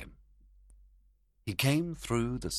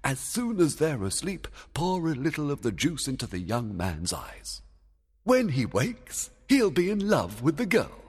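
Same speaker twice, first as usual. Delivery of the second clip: fairy king giving orders; 8 semitones higher than usual. A narrator's reading. A king instructs Puck about the juice, in a higher character voice. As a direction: voice the king laying out his plan.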